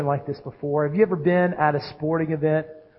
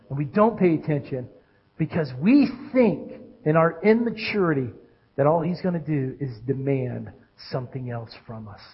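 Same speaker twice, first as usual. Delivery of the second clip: usual speaking voice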